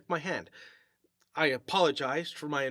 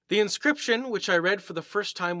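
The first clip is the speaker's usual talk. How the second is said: plain reading voice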